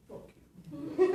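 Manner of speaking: loud, high jeering shout; laughing mock heckle; mock crowd yell. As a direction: yell it at the actors like a rowdy crowd. Hostile crowd yelling at actors.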